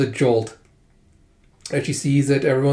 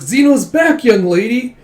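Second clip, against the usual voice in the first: high, loud, playful announcement; cheerful greeting; 9 semitones higher, 6 dB louder, slower than usual. Retold or imitated. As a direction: announce a happy return, pitched up. Zeno greets the lady on returning.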